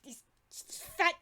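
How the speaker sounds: contemptuous high-pitched goblin; bitter goblin. Acted. Goblin names the culprits.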